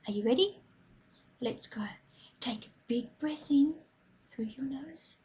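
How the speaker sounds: calm breathing guide, softly hushed; guided meditation. Acted. Leads a mindful breathing moment.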